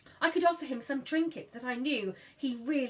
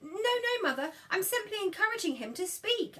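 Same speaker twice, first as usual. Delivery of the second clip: higher, prim innocent protest; Hetty protesting; 9 semitones higher than usual. Acted. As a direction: play the innocent. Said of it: "I said".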